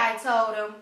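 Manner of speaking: ordinary delivery, nothing marked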